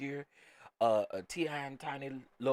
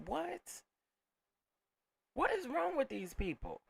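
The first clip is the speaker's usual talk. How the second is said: much higher, exasperated questioning; incredulous outrage; 13 semitones higher than usual. Real, not acted. Scolding commenters, a high incredulous what. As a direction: ask it in disbelief at them.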